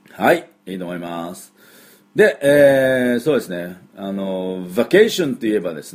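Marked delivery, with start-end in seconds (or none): none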